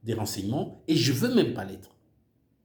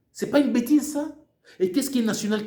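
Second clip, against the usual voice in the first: higher and louder, scornful disbelief; indignant outburst; 8 semitones higher, 3 dB louder than usual. Real, not acted. Demanding the audience see the absurdity, outraged. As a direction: throw the question at them in outrage.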